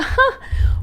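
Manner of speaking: high, breath-only mock "hah"; mock scoff laugh. Clients seeing out of office email, high mocking laugh.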